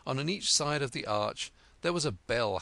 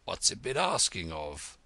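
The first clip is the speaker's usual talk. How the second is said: deep hoarse frog; 6 semitones lower than usual. Acted. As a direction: croak it out like a weary old frog. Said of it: "He was so hoarse".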